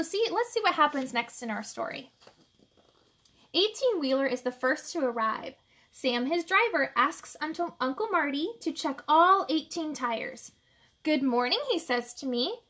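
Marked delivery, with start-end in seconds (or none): none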